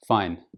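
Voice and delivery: "fine" falling low, with no lift; a flat, uninterested "fine"; flat intonation. Shown as a demonstration. Non-native speaker answering how they are, flat intonation. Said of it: "respond with a flat intonation".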